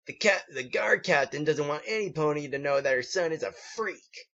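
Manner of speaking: lightly voiced bully; taunting Fritz. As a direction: sneer out the secret.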